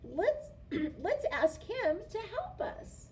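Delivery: a hint of a chirpy little clown. A narrator's reading. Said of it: "said the funny little clown".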